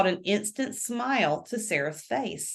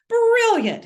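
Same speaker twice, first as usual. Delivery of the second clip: high, loud burst of delight; British lawyer delighted by her confession; 14 semitones higher, 8 dB louder than usual. A narrator's reading. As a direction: cheer it with a wink. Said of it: "he said with a wink".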